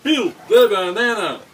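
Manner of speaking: high, loud, slow chant; shouting. Pretend. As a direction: bellow it out slowly. Quoting a meme chant.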